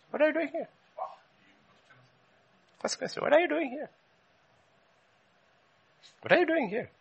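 high, soft questioning voice; a gentle voice asking from above; God's question. Acted. God asking Elijah at the cave, high and soft.

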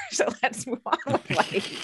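high, slow, laughing through the words. Real, not acted. Parent laughing while ending the story. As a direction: let the laugh carry the line.

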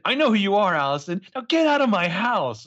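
hammed-up soap threat; imitating Taylor. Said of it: "And she's like".

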